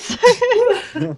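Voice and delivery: laughing through a loud surprise; laughing shout. Acted. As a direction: shout it while cracking up.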